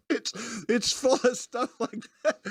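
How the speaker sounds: cracking up while trying to go on; real laughter. Real, not acted. Reviewer tells listeners about the film, still laughing at his impression. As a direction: talk through giggles, pitched high.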